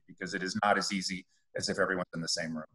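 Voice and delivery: usual speaking voice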